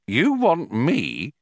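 slow, word by word; spelling it out. A narrator's reading. Prosser puzzling over Ford's offer, slow and deliberate. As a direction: lay each word down carefully, slowly. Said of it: "spelling out this new thought".

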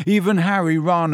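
ordinary delivery

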